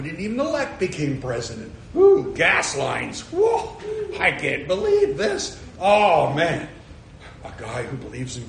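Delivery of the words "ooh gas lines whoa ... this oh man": high, laughing disbelief; mock astonishment. Preacher mimics a stunned seventies citizen, high and laughing. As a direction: squeal out the shock of it, laughing. Mock reaction to gas lines.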